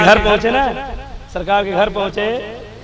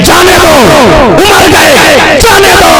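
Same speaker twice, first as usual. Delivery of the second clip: high, ringing preacher's cry; declaimed dismissal; 6 semitones higher, slower than usual. Acted. Preacher voicing the Prophet to Abu Huraira, high declaimed cry. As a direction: cry it out high over the crowd.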